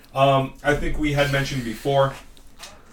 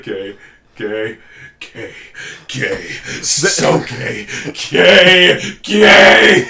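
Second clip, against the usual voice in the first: slow, rising, ever louder wizard chant; Pierce imitation; 3 semitones higher, 11 dB louder, much slower than usual. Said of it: "the Pierce part of Community".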